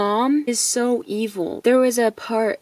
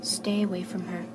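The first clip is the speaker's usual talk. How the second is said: hushed warning, softer and breathless; threatening whisper; 3 semitones lower, 9 dB quieter than usual. Retold or imitated. Simon warns the coworker off in a whisper. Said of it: "Simon said to him".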